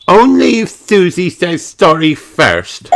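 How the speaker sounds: high stubborn piglet voice; stubborn demand. Acted. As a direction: insist she goes first.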